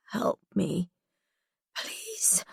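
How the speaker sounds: soft, fading plea; faint pleading. A narrator's reading. Drugged prisoner begs her rescuer, faint plea. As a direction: beg weakly, barely audible.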